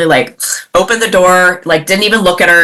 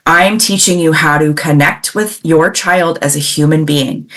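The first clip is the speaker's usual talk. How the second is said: ordinary talk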